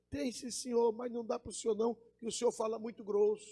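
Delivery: soft, high, dainty-voiced boss; thin high voice. Boss refusing the gruff cowboy a job, thin high voice. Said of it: "ele fala fino".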